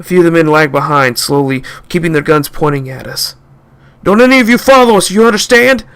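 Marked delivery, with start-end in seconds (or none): 4.0-5.8 loud, fast menacing order; threatening warning. Acted. Men with guns to the group: loud fast threat. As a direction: warn them off hard.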